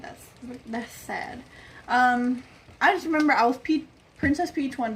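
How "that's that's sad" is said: much softer, slow whisper; whispered aside. Real, not acted. Not remembering her costumes, slow whispered aside. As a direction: mutter it under your breath.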